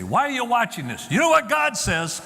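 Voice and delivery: hammed-up accusing mother; mock scolding. Parent who handles it wrong confronting the son, scolding. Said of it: "didn't say".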